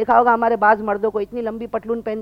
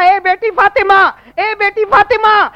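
high, loud, slow acted wail; tearful cry; 10 semitones higher, 9 dB louder, slower than usual. Preacher voicing a weeping father to Fatima, anguished cry. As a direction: call out in drawn-out mock-weeping anguish. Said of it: "ابا نے روتے روتے کہا".

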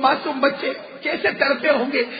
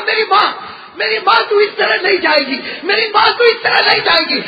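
put-on high, loud wail of a child clinging to the shroud; wailing cry; 7 semitones higher, 5 dB louder than usual. A narrator's reading. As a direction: cry out like a child losing his mother. (بچے کی روتی ہوئی بلند چیخ)

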